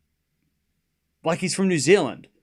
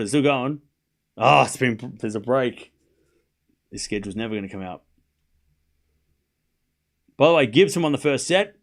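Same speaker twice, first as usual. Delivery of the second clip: ordinary talk, nothing marked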